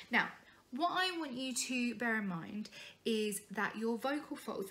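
ordinary talk

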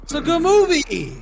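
mock enthusiastic. Ironic high praise.

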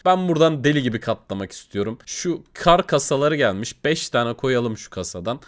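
ordinary talk

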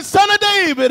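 very high, loud desperate-beggar cry; preacher's full-voiced cry; shouted. A narrator's reading. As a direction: shout it over those hushing you. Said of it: "But he shouted".

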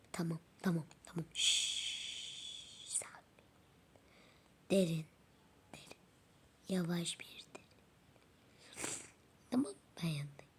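soft whispered shushing, very quiet; calm her breathing with a hush; mother doll whispering to calm her hurt child. Pretend.

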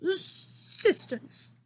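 slow, breathy proud word; proud giant. Acted. The BFG finding the right word, beaming. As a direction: savour the found word.

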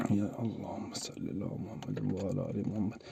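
hushed prayer under rubble; whispered prayer. Real, not acted. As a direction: whisper the blessing, barely voiced. Trapped in rubble, praying.

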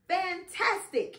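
usual speaking voice

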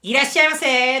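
high, loud shopkeeper welcome; high shop greeting. Shown as a demonstration. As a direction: chirp いらっしゃいませ high and bright at the door. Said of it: "お店に入って".

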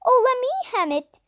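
fast, high eager plea; begged. A narrator's reading. Sister begging to hem the cloth, quick and high. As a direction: rush it, eager to help. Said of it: "Violet begged".

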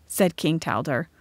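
ordinary delivery, nothing marked

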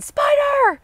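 piercingly high, loud little-girl impression; super high-pitched little girly voice. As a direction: imitate a toddler squealing the word, very high and loud. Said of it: "with a super high-pitched little girly voice".

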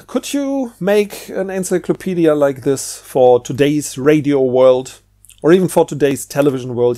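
ordinary talk

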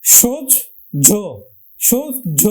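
teacher spelling out সোজ ঝ syllable by syllable; slow syllable demo. Shown as a demonstration. Teacher breaks the sound into parts for students. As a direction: sound out সোজ then ঝ, each part clear. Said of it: "ওকার দিলে উচ্চারণ হলো কিন্তু কি?".

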